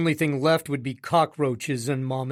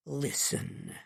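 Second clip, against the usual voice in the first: a soft coaxing Listen, much softer; wheedling; 11 dB quieter than usual. A narrator's reading. Father wheedling his son on the phone, soft and coaxing. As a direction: coax him back after the outburst. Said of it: "my father wheedles".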